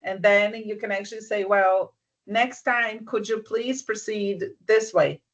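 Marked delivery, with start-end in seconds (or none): none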